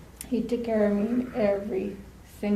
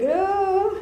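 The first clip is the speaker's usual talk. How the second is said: high-pitched, louder "oh"; imitated squeamish cry; 11 semitones higher, 5 dB louder than usual. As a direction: reenact a startled, squeamish yelp. Imitating own cry while lifting patient.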